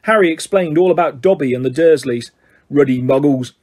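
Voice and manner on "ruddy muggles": lightly growled grumble; a touch of growling disgust; mild character growl; Hagrid growl. A narrator's reading. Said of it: "growled Hagrid".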